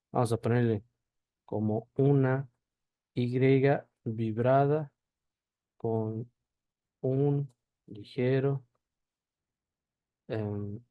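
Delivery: usual speaking voice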